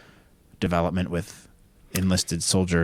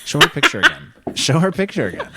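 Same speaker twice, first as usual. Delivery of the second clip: laughing; 9 semitones higher, 5 dB louder than usual. Real, not acted. Laughing, asking to see photo.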